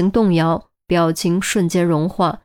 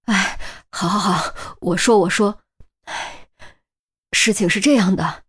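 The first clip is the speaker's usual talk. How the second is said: frightened. Acted. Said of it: "吓得脸都白了".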